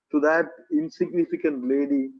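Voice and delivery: ordinary talk, nothing marked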